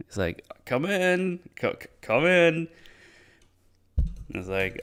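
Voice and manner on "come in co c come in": high, sing-out invitation; eager welcome. Retold or imitated. Storyteller voicing Hamle luring in the cash carrier, eagerly welcoming. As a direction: put on a sudden over-friendly call. Character suddenly welcomes him in.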